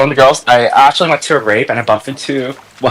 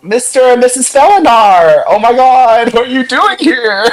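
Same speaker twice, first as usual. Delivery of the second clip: much higher, overjoyed fake surprise; mock excited greeting; 10 semitones higher than usual. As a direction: greet the friend's parents with hammed-up delight. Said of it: "I'm like".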